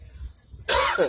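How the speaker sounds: harsh hacking cough; rough smoker's hack mid-sentence. Real, not acted. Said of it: "Smoker's cough".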